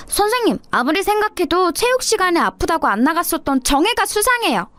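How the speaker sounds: put-on high, sneaky child's voice; sly accusing schoolkid. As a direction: frame her to the teacher. Kid accusing classmate.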